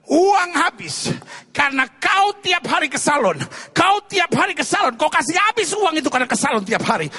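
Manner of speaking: loud, high-pitched scolding; angry husband. Acted. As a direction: berate the wife at full voice. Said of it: "suaminya marah".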